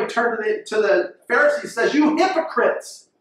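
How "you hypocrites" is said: rebuking. Retold or imitated. Jesus rebuking the Pharisees.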